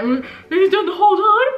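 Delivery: high squeaky laugh at the end; laughing, voice going up. Real, not acted. Owning up to her laughing habit, laughing voice going up. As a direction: laugh through it, pitch climbing. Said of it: "my voice goes up at the end when I'm laughing".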